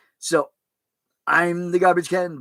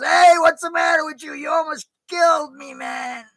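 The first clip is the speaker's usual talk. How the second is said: high, loud startled yell; mock yell; 6 dB louder than usual. Scared passenger yelling at the reckless cabbie, high mock shout.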